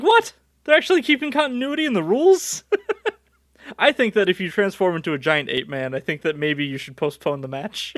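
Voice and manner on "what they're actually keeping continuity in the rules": high, startled what, then quick question; acted disbelief. Viewer amazed the show keeps its rules, incredulous. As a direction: ask it in high-pitched mock astonishment.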